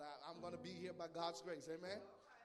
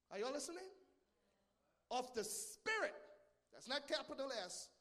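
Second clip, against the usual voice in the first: forceful preaching; 7 semitones higher, 6 dB louder than usual. Real, not acted. Pastor warning congregation about the spirit of devils, fervent preaching. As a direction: preach it hard, pitch soaring, pausing between phrases.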